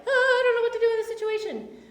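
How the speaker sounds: high sing-song mock panic, quick; flustered overwhelmed. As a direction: play a helpless, overwhelmed disciple in a lilting voice. Acted flustered overwhelmed quote.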